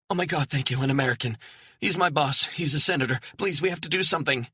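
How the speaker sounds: frightened woman pleading with a stranger; panicked relief. Acted. Relieved, panicked woman begs hiker.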